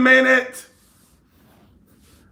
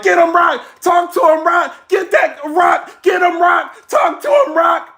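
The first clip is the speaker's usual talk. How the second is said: shouted and higher, egging on a battler; hype man yelling; 6 semitones higher than usual. Retold or imitated. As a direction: yell encouragement from the side of the stage. Bigums hyping from the stage.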